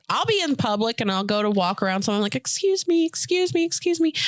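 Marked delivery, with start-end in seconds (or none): 2.3-4.1 high, singsong excuse-me; repeated polite call. Retold or imitated. Trying to get past someone, high polite calling.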